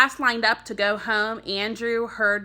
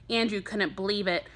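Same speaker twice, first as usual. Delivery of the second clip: usual speaking voice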